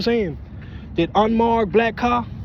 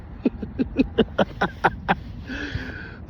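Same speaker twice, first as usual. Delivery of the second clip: airy unvoiced chuckle; breathy laugh; 5 semitones higher, 6 dB quieter than usual. Real, not acted. Laughing at onlooker.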